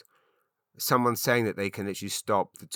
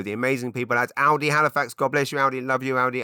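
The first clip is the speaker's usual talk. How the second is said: excited; 5 semitones higher, 6 dB louder, faster than usual. Real, not acted. Sponsor shout-out.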